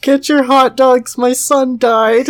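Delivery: high, loud ballpark hawker call; mock vendor's singsong cry; hot dog vendor. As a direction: call it out like selling at a game.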